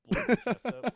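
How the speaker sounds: high, loud excited blurt; excited burst. Real, not acted. Excited recap of explosion.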